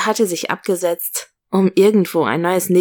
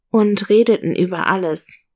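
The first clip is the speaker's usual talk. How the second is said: plain reading voice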